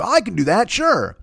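high, eager put-on voice; overconfident improviser. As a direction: play the guy claiming he can do it. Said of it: "The guy who claims he can do accents".

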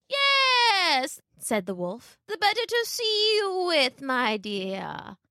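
slow, creaky fake grandmother; a wolf feigning a sick old lady; wolf posing as granny. Wolf tricking Little Red, faking a sick granny.

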